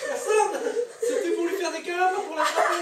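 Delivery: high, loud, laughing through words. Real, not acted. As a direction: talk while cracking up. Laughing while chasing a pet.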